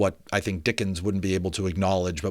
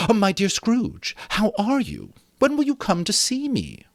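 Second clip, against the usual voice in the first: much higher, sing-song welcome; gladsome greeting. Acted. Passer-by hailing Scrooge warmly, high gladsome greeting. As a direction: greet him like a beaming friend. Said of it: "with gladsome looks".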